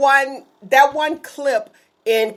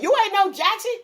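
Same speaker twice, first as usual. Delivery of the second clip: mock dismissal; 7 semitones higher, slower than usual. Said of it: "like he said".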